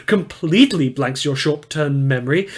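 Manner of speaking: ordinary delivery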